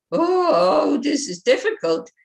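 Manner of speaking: whiny discouraged. Shown as a demonstration. Teacher to students, showing the defeated way: whiny crying moan. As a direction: moan it like you're giving up. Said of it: "it kind of blocks you".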